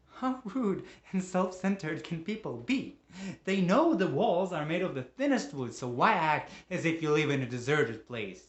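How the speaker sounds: loud, exasperated, mounting outrage; angry rant. Acted. Man rails at noisy neighbors, angry rant.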